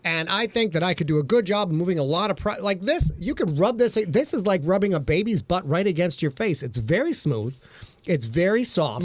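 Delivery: lilting home-shopping presenter patter; hammed-up shopping channel pitch; QVC host. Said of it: "He wants to break into Q V C".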